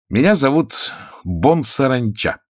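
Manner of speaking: drawn-out, grudging captive's answer; reluctant admission. A narrator's reading. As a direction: give up the name unwillingly. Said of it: "неохотно признался Пашка".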